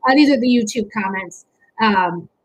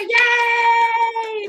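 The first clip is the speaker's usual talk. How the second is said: high, loud little cheer; happy yay shout; excited cheer; 8 semitones higher, 4 dB louder than usual. Real, not acted. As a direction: cheer her arrival. Cheering Megan's arrival.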